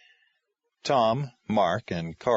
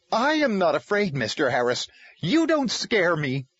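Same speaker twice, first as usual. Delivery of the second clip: defiant; 8 semitones higher, 4 dB louder than usual. Acted. Caseworker denies fear to Tom, defiant and angry. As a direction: fight back at him. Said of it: "She fought back".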